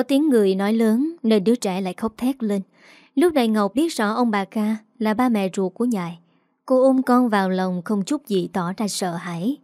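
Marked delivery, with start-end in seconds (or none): none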